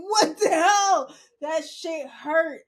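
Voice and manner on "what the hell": loud laughing disbelief. Retold or imitated. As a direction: laugh it out, stunned. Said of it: "I was like".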